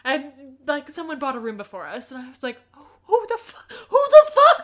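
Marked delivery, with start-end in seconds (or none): 2.8-4.6 high, loud, laughing disbelief; squawked mock bewilderment; incredulous outburst. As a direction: blurt it high, then louder and cracking into a laugh. Retelling own baffled outburst.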